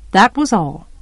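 plain reading voice

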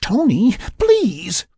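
high, loud desperate plea; pleading. A narrator's reading. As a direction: beg him in a high voice. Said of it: "Sarah pleaded".

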